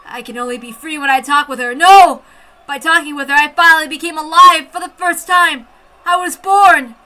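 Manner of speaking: building to a loud, high cry; rising impassioned shout; desperate outburst. Acted.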